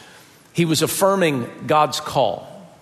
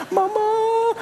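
sweet falsetto. Retold or imitated.

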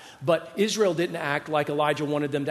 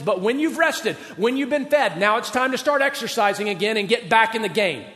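higher and louder, driving exhortation; full-force preaching; 6 semitones higher, 5 dB louder than usual. Real, not acted. Pastor urges hurt churchgoers back into ministry, full-force preaching. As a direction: preach it hard to the pews.

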